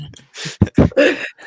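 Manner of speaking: high, breathy giggle that breaks through; can't hold back the giggle; burst of laughter. Real, not acted. Student cracking up mid-answer, loud high giggle.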